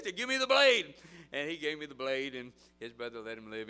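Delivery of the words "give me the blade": much louder, high-pitched retold yell; shouted demand. Sunday school leader to crying kid, shouted order. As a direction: bellow it over the wailing.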